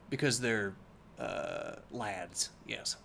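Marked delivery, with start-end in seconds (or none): none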